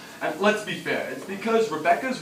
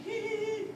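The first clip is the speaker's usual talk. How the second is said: tiny, high, soft hee-hee; squeaky little tittering; mimicked kid's giggle; 11 dB quieter than usual. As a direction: mimic a sneaky child's giggle, very high and quiet. Kid's giggle behind him, mimicked.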